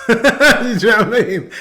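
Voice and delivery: amused, laughing it out. Real, not acted. Joking that kids end up rappers, laughing.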